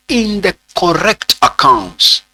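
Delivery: "in the correct accounts" spaced out deliberately; emphatic dictation. Real, not acted. Teacher dictating where a transaction is recorded, deliberate emphatic dictation. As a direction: stretch "in the correct accounts" for note-takers. Dictating the key words of definition.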